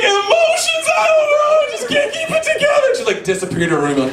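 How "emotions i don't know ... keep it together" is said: very high, wailing meltdown voice; hysterical mock wailing. Imitating sister falling apart over emotions, hysterical wail. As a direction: wail it like an overwrought teenager.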